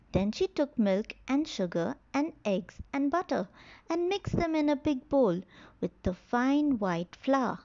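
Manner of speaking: usual speaking voice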